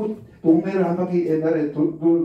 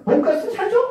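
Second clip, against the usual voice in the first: put-on pleading child; 11 semitones higher than usual. Child asking for dinner out.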